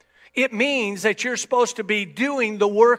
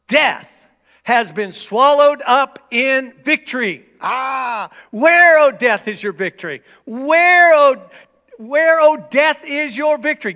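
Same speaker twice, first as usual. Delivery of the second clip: preaching at full force; 5 semitones higher, 8 dB louder than usual. Real, not acted. Preaching climax, shouted scripture.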